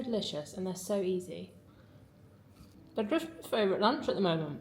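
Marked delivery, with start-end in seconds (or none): 3.0-4.6 talking through a mouthful; words round a bite of food; mouth full. Real, not acted. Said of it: "Excuse me for talking with my mouth full".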